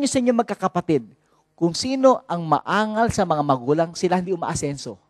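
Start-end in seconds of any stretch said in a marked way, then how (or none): none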